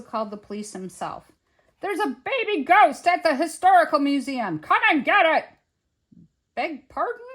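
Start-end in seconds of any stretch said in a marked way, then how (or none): 1.8-5.4 loud, high frantic report; agitated shouting. A narrator's reading. Museum man calling the police himself, loud agitated shout. 6.5-7.3 high, questioning reply; puzzled police. A narrator's reading. Police answering a shouting caller, puzzled query.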